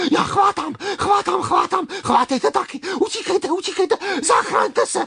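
very high, loud, rushing beetle voice; frantic cry. Acted. Beetle urging everyone to save themselves, frantic cry. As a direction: shriek the warning as you hurry off. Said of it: "vykřikl kvapník".

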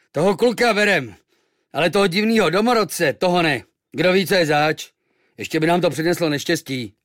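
suspicious crew speaking loud; gruff sailors. Acted. Sailors voiced.

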